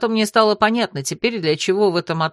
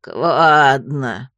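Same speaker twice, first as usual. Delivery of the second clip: low, bubbling croak through the throat; gargling croak; 7 semitones lower, 4 dB louder than usual. A narrator's reading. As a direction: gurgle it like a puffed-up toad. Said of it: "булькнула раздувшимся горлом жаба".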